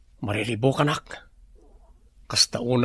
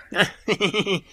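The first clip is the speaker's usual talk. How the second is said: a henchman's high cackle; gleeful laugh; 7 semitones higher than usual. Acted. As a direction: laugh along with the chief's plan. Henchman laughs with his chief.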